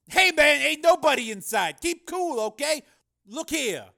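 very high, loud street-hustler voice; pimp. Acted. Pimp showing the gunman an empty car, agitated and loud. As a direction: placate the gunman, pitched far up.

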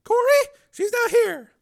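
put-on very high, loud pleading girl; terrified girl. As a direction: shriek in terror. Frightened girl.

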